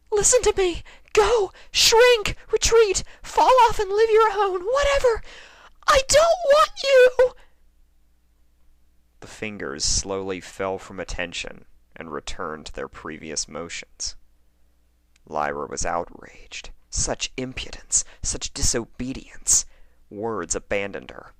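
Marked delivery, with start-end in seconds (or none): 0.1-7.3 shrill screaming orders, much higher and louder; frantic screaming. A narrator's reading. Lyra screaming at the fingers. 16.9-19.7 indignant whisper, a bit higher; outraged. A narrator's reading. Lyra outraged.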